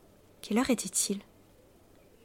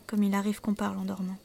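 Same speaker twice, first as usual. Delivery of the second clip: usual speaking voice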